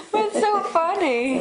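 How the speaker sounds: high giggly question through a laugh; words bubbling with laughter; laughing along. Real, not acted.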